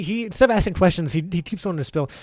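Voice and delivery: usual speaking voice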